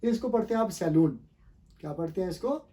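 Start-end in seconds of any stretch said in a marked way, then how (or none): 0.7-1.2 सैलून with a long oo, "sai-LOON"; wrong oo reading of सैलून; common mispronunciation. Shown as a demonstration. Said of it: "not सैलून".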